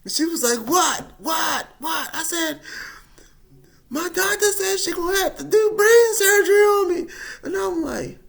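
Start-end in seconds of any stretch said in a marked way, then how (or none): 0.7-2.1 high, loud panicked what; mother startled into shouting; alarmed mom. Retold or imitated. Mom answering her panicked son, shouting. 3.9-7.1 high, loud blurted news; panicked shout. Retold or imitated. Telling mom about brain surgery, panicked shout.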